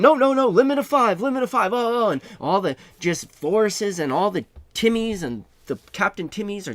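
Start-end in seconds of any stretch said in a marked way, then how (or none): 0.0-2.1 loud high protest, hammed up; mock yelling. Another shopper shouting the limit back, loud high yell. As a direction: yell the correction over the crowd. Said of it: "Another guy's yelling".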